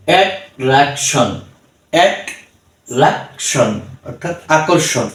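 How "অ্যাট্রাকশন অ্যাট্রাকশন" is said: অ্যাট্রাকশন slowly split into অ্যাট, রাক, শন; slow অ্যাট্রাকশন with gaps between syllables; three-syllable pronunciation. Shown as a demonstration. Teacher reading in three syllables, অ্যাট্রাকশন slow and broken up. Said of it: "এটা আমরা তিন সিলেবলে পড়লাম".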